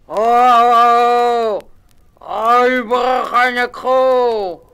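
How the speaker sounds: loud, high booming shout; robber's call. A narrator's reading. Robber at the cliff calling his band, booming shout. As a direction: bellow it out like a robber chief.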